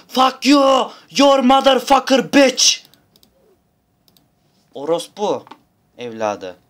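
high, loud swearing, slow at the end; furious shout. Pretend. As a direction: yell the curse at the enemy. Cursing at game enemy. (yüksek sesle öfkeli küfür)

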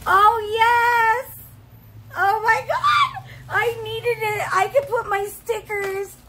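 high, loud real cries of joy; thrilled shout. Real, not acted. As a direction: shout your delight, way up, half-laughing. Thrilled over the cup gift.

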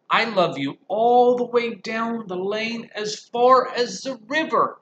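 high, loud and slow; eager cry of a little bunny; little hare crying out. Acted. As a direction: call out as far as you can. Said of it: "cried Little Nut Brown Hare".

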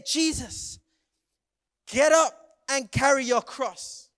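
loud, forceful order in separated bursts; barked command. Acted. Soldiers order Jesus to carry the cross, loud command. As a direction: order the prisoner up, hard and loud.

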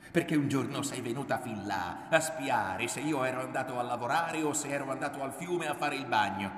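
sustained high, indignant protest; angry accusation. A narrator's reading. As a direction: accuse her of spying on you. Son goes on shouting accusation.

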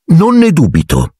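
high-pitched, suddenly cross retort; frowning. A narrator's reading. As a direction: answer with sudden motherly displeasure. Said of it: "improvvisamente accigliata".

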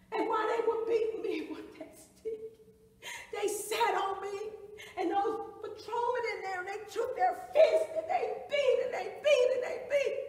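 high, loud, mounting distress; anguished account. Acted. Survivor reliving patrolmen's fists, mounting anguished cry. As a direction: build to a peak on the repeated blows.

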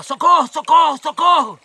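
high, loud cries of socorro; panicked shouting for help; retold cry for help. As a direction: yell for help like a man in panic. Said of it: "começou a gritar".

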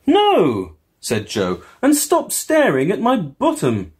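high, loud, fed-up protest; mock-indignant high voice; exasperated Joe. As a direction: snap back at staring kids.

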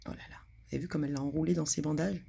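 quick excited whisper. A narrator's reading. Storyteller whispering fast to children about the bandages. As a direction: rush it out in a whisper.

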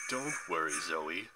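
low, mock-soothing Titan voice; menacing Atlas. A narrator's reading. Atlas answers his daughter Zoe, menacing. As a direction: drop deep and falsely reassure her.

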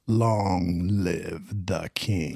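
low, very slow villain voice; hushed, drawn-out cartoon villain; Scar. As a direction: draw each word out softly and menacingly. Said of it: "Scar and Lion King".

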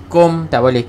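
usual speaking voice

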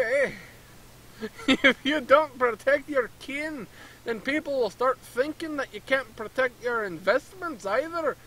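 hammed-up Canadian merchant tacking on eh; a merchant asking a stranger for help in a mock Canadian accent. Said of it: "It's obviously Canadian".

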